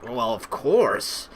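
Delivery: slow, drawn-out "Well, of course"; condescending. Shown as a demonstration. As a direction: talk down on "Well, of course", unhurried. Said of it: "saying it in a condescending way".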